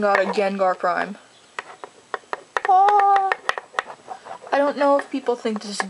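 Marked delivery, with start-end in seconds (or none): 2.7-3.3 loud, very high "oh"; thrilled squeal. Real, not acted. Thrilled at pulling Gengar Prime.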